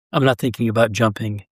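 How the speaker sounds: ordinary delivery, nothing marked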